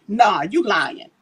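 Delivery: usual speaking voice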